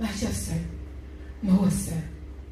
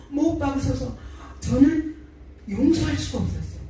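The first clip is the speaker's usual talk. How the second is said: ordinary delivery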